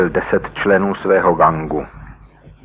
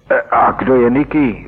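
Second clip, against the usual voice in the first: nervous question; 5 semitones higher than usual. Acted. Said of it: "vypravil ten druhý ze sebe".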